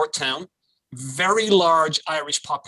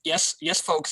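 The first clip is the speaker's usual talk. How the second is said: usual speaking voice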